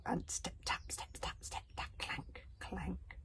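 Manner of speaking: hushed, creeping whisper; whispered footsteps. A narrator's reading. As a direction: whisper it like someone sneaking closer. Footsteps approach ominously.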